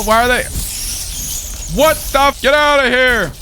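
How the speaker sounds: panicked yelling. Real, not acted. Player swarmed by a bunch of rats, panicked yelling. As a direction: shriek at them to get away.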